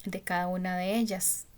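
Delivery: ordinary talk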